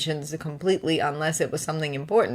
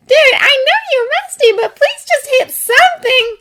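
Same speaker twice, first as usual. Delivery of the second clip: teasing gamer friend; 10 dB louder than usual. Acted. Friend teasing during game.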